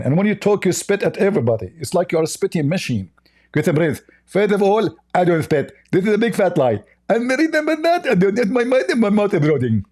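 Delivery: high, squeaky mock voice; a hammed-up high caricature voice; mocking Zakir Naik. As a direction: lampoon the opponent in a silly high pitch.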